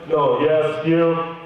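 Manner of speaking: curt official at the interview; no-nonsense officer voice; stern interrogator. Retold or imitated. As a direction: summon them like an interrogator. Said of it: "the tone became immediately different".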